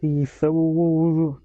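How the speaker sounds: can't get "Wool" out, so it smears into a drawn-out oo; mispronounced. Real, not acted. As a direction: stumble on "Wool", dragging it out slowly. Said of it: "I can't say wool".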